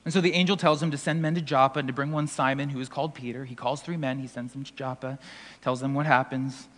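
calm Bible story narration; scripture retelling. Acted. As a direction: ease through the story quietly. Retelling scripture scene quietly.

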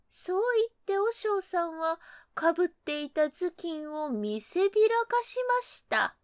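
ordinary delivery, nothing marked

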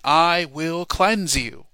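loud, slow "I will cleanse you"; slow loud preaching declaration; emphatic proclamation. Shown as a demonstration. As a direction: thunder it out like the prophet. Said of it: "he says it again".

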